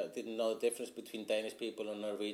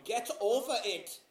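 mocking impatient foreigner; 10 semitones higher, 4 dB louder than usual.

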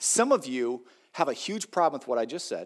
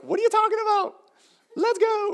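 put-on much higher, eager little kid; toddler voice; 6 dB louder than usual. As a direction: play a toddler who won't listen. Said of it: "a three-year-old's like".

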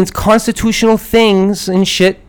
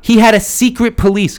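usual speaking voice

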